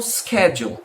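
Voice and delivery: schedule begun with SK, SKED-jool; American pronunciation. Shown as a demonstration. Teacher quoting how Americans say it, schedule as SKED-jool. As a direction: say schedule with a hard K. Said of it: "Os americanos normalmente pronunciam".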